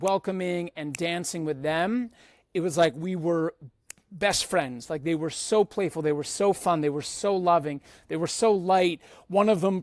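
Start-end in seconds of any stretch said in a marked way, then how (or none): none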